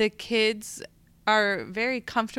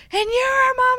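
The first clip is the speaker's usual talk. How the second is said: put-on much higher, louder kid's voice; child voice; 9 dB louder, faster than usual. Child telling the teacher she is their mom. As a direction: chirp it like an eager little child. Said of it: "And they're like".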